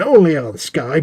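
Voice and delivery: hammed-up TV promo ending; mock ad tagline. Announcer closing a spoof ad, loud and punchy.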